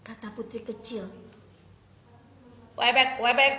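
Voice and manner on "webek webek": louder croaking frog; mock frog reply. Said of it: "jawab tadi".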